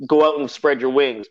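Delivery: ordinary delivery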